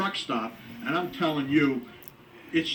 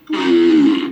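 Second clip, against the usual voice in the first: loud fart noise; 11 semitones lower, 13 dB louder than usual. Acted. Fart interrupts the girl's line.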